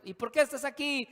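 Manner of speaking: high-pitched, insistent questioner; prisoner interrogating. Acted. Inmates asking each other.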